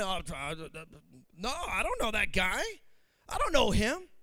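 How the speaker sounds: mock denial. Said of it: "Now all of a sudden".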